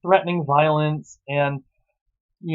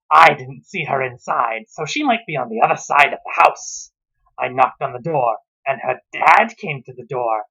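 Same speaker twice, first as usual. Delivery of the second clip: mock-earnest book reading; reading aloud. Reviewer reading a bad novel aloud to viewers, mock-earnest. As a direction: read the passage like a dry narrator.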